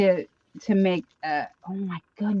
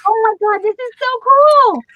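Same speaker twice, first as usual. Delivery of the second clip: excited squeal; 12 semitones higher, 7 dB louder than usual. Real, not acted. Unboxing the last surprise.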